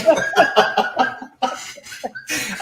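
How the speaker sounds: long, high, loud laugh; high peal of laughter; real laughter. Real, not acted. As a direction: burst out laughing. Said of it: "I'm laughing".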